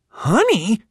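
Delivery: put-on high, incredulous kid's echo; stunned question. Wide-eyed boys hearing the teacher call him honey, stunned. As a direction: repeat it in disbelief.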